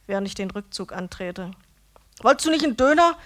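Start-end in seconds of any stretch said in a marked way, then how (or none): 2.2-3.2 higher, louder call with mouth full; calling after. A narrator's reading. Döner man calling after the leaving narrator, mouth full. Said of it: "ruft er mir mit vollem Mund hinterher".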